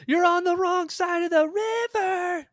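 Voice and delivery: high, loud, drawn-out movie-quote yell; mock film shout. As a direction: holler it slowly, as if across a river.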